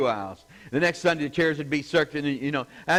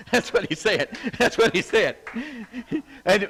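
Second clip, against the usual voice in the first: laughing; 7 semitones higher than usual. Real, not acted. Storyteller cracking up at the pastor's words, laughing insistence. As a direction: insist through real laughter, higher pitched.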